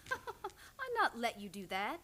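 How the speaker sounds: slow, easy words through a laugh; laughing reply. Acted. [name] teasing Matt after his scolding, laughing.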